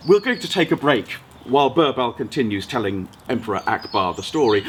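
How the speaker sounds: ordinary delivery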